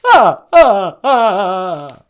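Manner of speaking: Count's ah ah turned into a hammed-up sob; mock crying. Shown as a demonstration. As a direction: fake a sobbing ah ah. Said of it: "This is how I cry".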